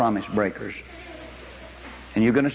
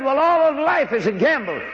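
mock skeptic; 13 semitones higher, 3 dB louder than usual. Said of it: "Somebody said".

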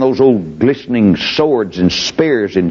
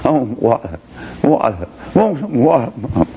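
put-on thirsty child. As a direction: whimper for mama, parched. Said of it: "the little children were hollering".

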